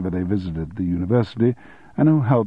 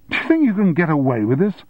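high, raised indignant shout; 8 semitones higher than usual. A narrator's reading. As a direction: yell it at the mechanic in outrage. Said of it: "shouted Keith".

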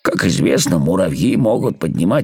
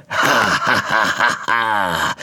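slow villain's ha-ha-ha; evil laugh; slower than usual. Acted. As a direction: laugh like a gloating spider. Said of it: "Засмеялся паук злобным смехом". (медленный злобный смех паука)

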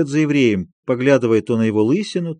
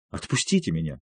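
much lower, soft trembling plea; 8 semitones lower, 10 dB quieter than usual. A narrator's reading. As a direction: beg quietly, voice breaking. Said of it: "голос его дрогнул".

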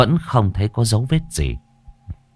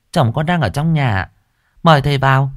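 put-on higher, polite young wife's voice; wife calling in; 6 semitones higher than usual. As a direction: invite the master in politely. Wife announces guest.

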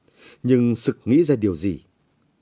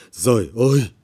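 louder, anguished outcry; anguished cry; 5 dB louder than usual. Acted. Mother cries out at the demanded bribe, anguished. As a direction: cry out to heaven in despair. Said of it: "Mẹ tôi rú lên".

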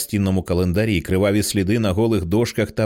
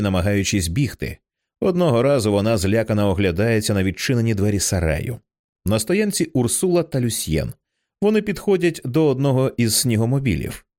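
usual speaking voice

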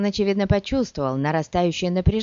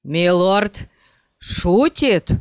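shaky, louder pleading; trembling voice; 4 dB louder, much slower than usual. Acted. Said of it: "дрожащим голосом проговорил Корба".

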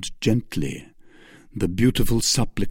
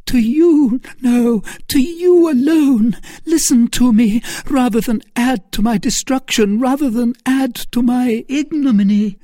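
frantic pleading; 14 semitones higher, 6 dB louder than usual. Acted. Milady's frantic plea.

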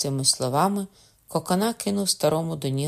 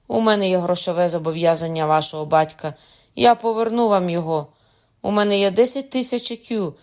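raised, urgent begging; desperate pleading; 5 semitones higher, 6 dB louder than usual. A narrator's reading. Father offering everything for his son's life, desperate pleading. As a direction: plead harder toward the last words. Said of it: "не переставав Меркандон, щіплюючи руки".

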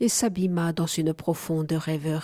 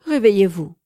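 louder call, falling hard at the end; loud command; 7 dB louder than usual. A narrator's reading. Goddess urging the dreamer to wake, loud call. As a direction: order the sleeper to wake, loud. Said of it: "s'écria-t-elle".

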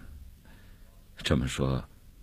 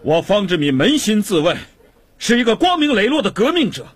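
loud, high-pitched ringing oratory; defiant declamation; 14 semitones higher, 5 dB louder than usual. Acted. Paraded prisoner refusing to bow his head, loud and defiant. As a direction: proclaim your innocence defiantly to the whole street. Prisoner defies guard before crowd.

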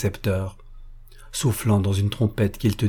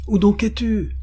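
appel crié; 11 semitones higher than usual. A narrator's reading. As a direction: question lancée très haut. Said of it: "cria encore la voix d'Eugène". (le gamin cherche Lydie et crie fort)

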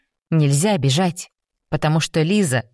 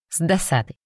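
ordinary delivery, nothing marked

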